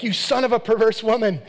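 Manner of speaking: mock furious shout at his son; Saul's angry insult. Father yelling at his son in front of the mother, mock shout. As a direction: bellow the insult, hammed-up. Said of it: "and he's like".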